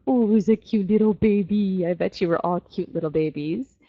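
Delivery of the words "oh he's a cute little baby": imitating baby talk over a crib; cooing parent. Mom and dad looking over the crib, cooing imitation. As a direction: coo it like a doting parent. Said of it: "your mom and dad are looking over you going".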